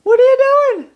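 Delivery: high pet-talk call; high dog-talk voice. Real, not acted. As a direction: squeal it at the pet.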